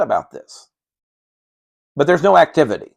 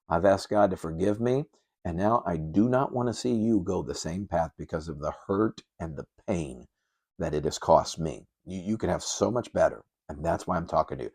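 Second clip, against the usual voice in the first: much softer, lower, sincere admission; humble confession; 7 semitones lower, 10 dB quieter than usual. Pretend. Parent confessing past sin to the children, soft and humble. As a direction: confess it gently to your kids, ashamed. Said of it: "you've got to humble yourself and say".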